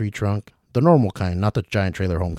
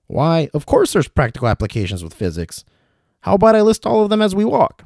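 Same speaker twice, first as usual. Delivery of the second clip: put-on dangling old traveler; 9 semitones higher, 5 dB louder than usual. William calling to Ricky from upside down, high and fussy. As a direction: call down politely from the rope.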